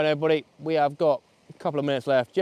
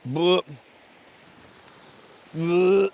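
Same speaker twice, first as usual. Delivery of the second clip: loud gagging spit-out noise; disgusted retching bleh; 5 semitones higher, 5 dB louder than usual. Real, not acted. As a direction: gag on something gross. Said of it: "swallowed a fly".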